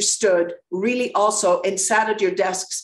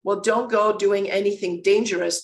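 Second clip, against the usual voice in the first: normal talking voice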